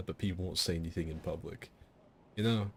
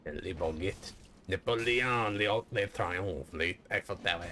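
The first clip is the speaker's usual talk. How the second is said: hammed-up mock French accent; cartoon Frenchman. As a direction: toss out French clichés in a silly French accent. Mock French name-dropping bit.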